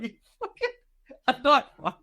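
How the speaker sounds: high, shocked squeal of disbelief. Retold or imitated. Hearing a mate explain his scar: high shocked disbelief. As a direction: react like you can't believe your ears. Shocked reaction to knife fight story.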